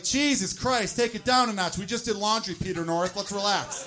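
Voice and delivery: hammed-up shout at your own load; mock exasperated outburst. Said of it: "it's like".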